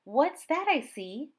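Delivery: playful bus driver. Acted. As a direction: draw it out like a magician's reveal. Driver plays magic trick.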